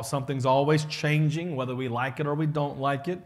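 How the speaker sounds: ordinary delivery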